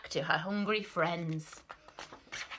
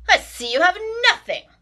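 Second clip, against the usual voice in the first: loud, higher grumble; complaining rat; 13 semitones higher, 11 dB louder than usual. Acted. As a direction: whine it greedily. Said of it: "the rat complained".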